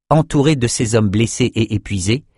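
usual speaking voice

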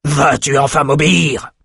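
plus haut, il explose d'impatience; éclat de colère. Acted. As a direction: explose de rage contenue. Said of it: "explosa Outros". (éclat de colère impérieux)

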